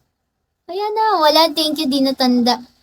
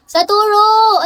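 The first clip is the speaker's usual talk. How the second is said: calling out; 6 semitones higher, 4 dB louder than usual. Real, not acted. Streamer greets a viewer joining the live, calling out. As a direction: call the name out high, stretching it. Calls out to a viewer who joins.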